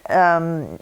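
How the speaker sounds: ordinary talk, nothing marked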